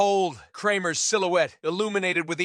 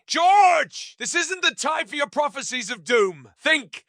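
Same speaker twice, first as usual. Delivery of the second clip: high, urgent panicked outburst; pitched-up, emphatic panic; panicking; 9 semitones higher, 5 dB louder than usual. A narrator's reading. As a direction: cry out the name loudly, then urge frantically.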